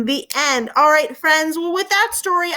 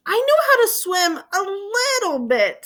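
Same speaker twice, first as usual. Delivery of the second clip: high, teary little monster voice; a hint of a sob in a storybook voice; tearful confession; 8 semitones higher, slower than usual. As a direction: let the voice crack as he admits it. Bonk admits he can't swim.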